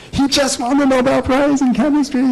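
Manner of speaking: high, bright-pitched blurt of the news; excited announcement. Retold or imitated. Telling the daughter about the Nobel Prize, high and excited. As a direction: burst it out like big family news.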